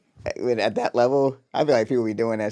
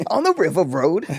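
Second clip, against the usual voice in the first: higher, hammed-up British accent; Idris Elba; 6 semitones higher than usual. Actor naming the place, hammed-up British accent. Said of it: "what's going on with your Idris Elba".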